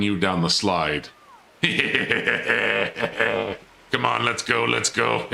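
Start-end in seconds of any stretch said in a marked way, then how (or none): 1.6-3.6 long staccato villain laugh; gloating cackle. Pretend. Player about to throw robot down slide, gloating cackle. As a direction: cackle with mock-evil glee.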